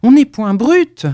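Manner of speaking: loud, high, indignant cry; angry outburst. A narrator's reading. Collard snaps at Rollet, insulted, in a loud angry cry. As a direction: fire it back at him, offended. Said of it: "Collard se fâcha".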